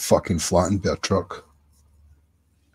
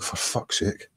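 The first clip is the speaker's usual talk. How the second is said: breathy under-the-breath curse, much softer; exasperated mutter; 10 dB quieter, slower than usual. Retold or imitated. Cyclist recalling the man nearly falling, muttering in exasperation. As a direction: mutter it to yourself, fed up.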